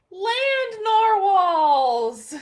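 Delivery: drawn-out, loud, high cry of wonder; amazed shout. Acted. Kelp spots the unicorns and shouts in amazement. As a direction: belt it out like you can't believe your eyes.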